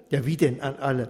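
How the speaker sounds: plain reading voice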